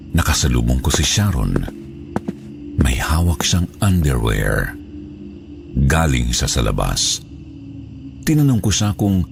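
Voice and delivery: usual speaking voice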